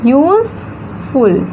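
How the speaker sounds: "Useful" very slowly, long break before फुल; syllable by syllable. Shown as a demonstration. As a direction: stretch use, wait, then फुल in "useful". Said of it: "कितना syllable?".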